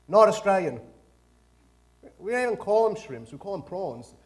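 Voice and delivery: ordinary delivery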